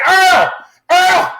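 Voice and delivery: high, loud frantic cry of a name; panicked scream. Retold or imitated. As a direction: scream for him in horror. Sees Earl in machine.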